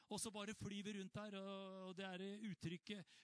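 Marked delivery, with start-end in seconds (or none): none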